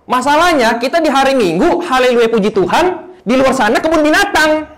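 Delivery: loud, high, driving sermon voice; a pastor's loud, fired-up call to the crowd; full-force preaching. Acted. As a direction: preach it out to the whole hall.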